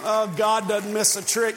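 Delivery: ordinary delivery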